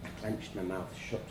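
plain reading voice

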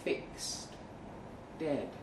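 usual speaking voice

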